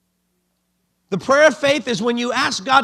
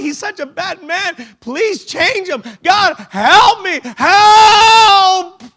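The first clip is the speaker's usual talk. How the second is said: much higher, slow wailing cries building to a loud sobbing shout; desperate pleading; 9 semitones higher, 6 dB louder than usual. Retold or imitated. Believer crying out to God for help, wailing. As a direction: beg God louder and louder until you break. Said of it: "we keep crying out".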